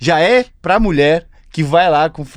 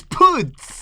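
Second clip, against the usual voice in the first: dismayed exclamation; 13 semitones higher than usual. Retold or imitated.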